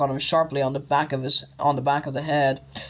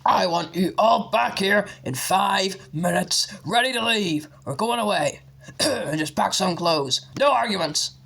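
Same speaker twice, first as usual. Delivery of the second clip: stern order; 5 semitones higher than usual. A narrator's reading. Vernon ordering everyone away.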